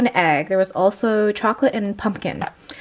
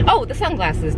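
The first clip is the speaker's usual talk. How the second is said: usual speaking voice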